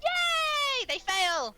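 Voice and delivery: very high, loud squeal of delight; triumphant cheer. Real, not acted. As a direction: cheer loudly at a lucky roll. Enemy fails the saving throw.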